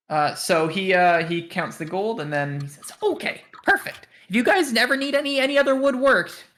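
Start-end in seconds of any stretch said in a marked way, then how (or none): 3.0-6.4 high-pitched shopkeeper character voice; high, salesy woodworker voice; chipper woodworker. Acted. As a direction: play the eager craftsman plugging his shop, pitched way up. Game master voices the woodworker.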